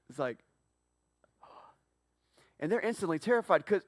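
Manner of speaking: usual speaking voice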